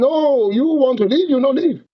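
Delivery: high, broken-English guard voice, lightly done; a hammed-up prison guard mocking inmates; mock Chinese accent. Chinese guard mocking screaming prisoners in a mock accent. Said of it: "some Chinese guard is like".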